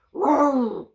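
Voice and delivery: loud, high mock dragon roar. Dragon roaring at the cook in the morning, loud and mock. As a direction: roar it out like a grumpy little dragon. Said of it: "said the Little Green Dragon".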